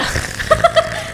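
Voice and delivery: high laugh. Real, not acted. Laughs at own joke.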